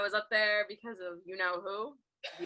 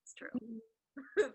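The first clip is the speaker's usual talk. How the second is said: whispering; 10 dB quieter, much slower than usual. Real, not acted. Confiding about the shirt.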